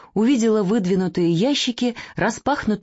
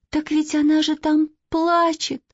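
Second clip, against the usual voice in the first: plaintive; 9 semitones higher than usual. Acted. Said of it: "жалобно сказала Катя".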